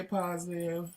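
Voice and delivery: usual speaking voice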